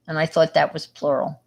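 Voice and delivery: ordinary talk, nothing marked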